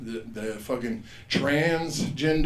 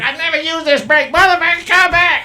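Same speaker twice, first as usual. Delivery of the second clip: much higher, louder shrieking; shrill angry lady; 13 dB louder than usual. Retold or imitated. Receipt lady yelling after him from her wheelchair. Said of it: "she's like".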